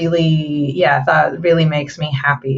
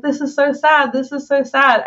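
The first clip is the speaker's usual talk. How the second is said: higher, lightly mock-sad teen; 8 semitones higher than usual. Quoting a streamer during credits, mock sad. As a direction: repeat it like a teary fan. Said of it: "she's like".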